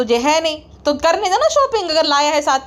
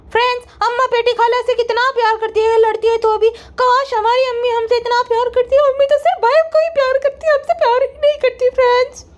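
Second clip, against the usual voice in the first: higher, sugary "friends" vlogger impression; mocking her sister-in-law's vlog voice, pitched higher; 9 semitones higher than usual. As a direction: ham up a fake-sweet influencer addressing fans.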